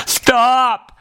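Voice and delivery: high, drawn-out cry of stop; exasperated high-pitched call. A narrator's reading. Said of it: "cried the mouse".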